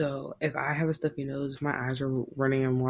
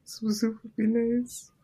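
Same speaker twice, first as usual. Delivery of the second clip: higher, slow, pitiful request; pitiful sick voice; 8 semitones higher, slower than usual. Pretend. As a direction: ask for soup like a sulking child. Mock pitiful wish for soup.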